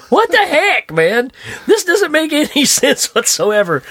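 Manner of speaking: high-pitched, baffled protest; mock disbelief. Viewer baffled by snooty people eating TV dinners, mock disbelief. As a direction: exclaim it high, amused and incredulous.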